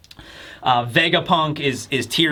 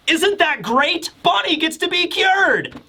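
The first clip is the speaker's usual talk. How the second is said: high, drawn-out mock delight; hammed-up cheer pitched way up, slowing on the question; mock joyful; 12 semitones higher, slower than usual. Kuma happy that Bonnie gets cured, mock joy. Retold Kuma's brave joy at sacrifice.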